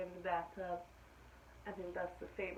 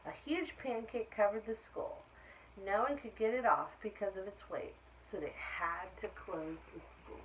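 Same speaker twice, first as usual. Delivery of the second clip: put-on lively read-aloud for children; animated story reading; storybook narration; 3 semitones higher, 3 dB louder than usual. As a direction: tell it like a bedtime picture book. Reads picture book aloud.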